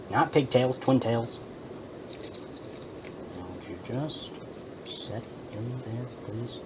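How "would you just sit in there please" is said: quiet pleading. Real, not acted. Pleading with figurine.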